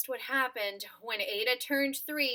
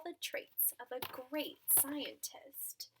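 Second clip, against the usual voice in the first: unvoiced whispered line; 9 dB quieter than usual. A narrator's reading. Narrator telling children a secret, whispered.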